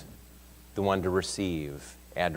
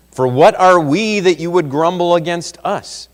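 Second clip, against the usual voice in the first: loud, higher indignant protest; indignant outcry; 9 semitones higher, 11 dB louder than usual. Acted. Moses protesting to the grumbling Israelites, loudly indignant. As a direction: throw the question back at them.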